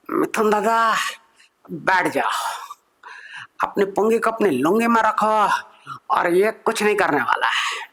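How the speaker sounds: mock stern elder voice, husky start; hammed-up Bollywood elder telling him to sit down; imitating a filmi elder. Elder tells comedian to sit down, mock stern.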